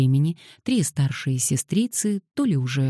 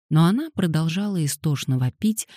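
plain reading voice

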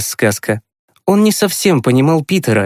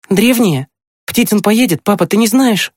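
put-on high, eager boy's voice; agitated questioning; 9 semitones higher than usual. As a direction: press father with high, excited questions. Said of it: "заволновался Тимкин и уточнил". (взволнованные вопросы высоким мальчишеским голосом)

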